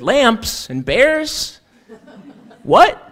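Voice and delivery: put-on high, slow, baffled shout of a deaf old man; confused shout. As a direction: holler back like you misheard. Deaf Elias mishears, confused.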